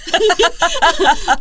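loud, high burst of laughter; real laughter. Real, not acted. Laughs at her own teeth remark.

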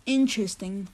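low, quiet, doubtful interesting; suspicious. Acted. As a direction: say it like you don't buy it. Skeptical host.

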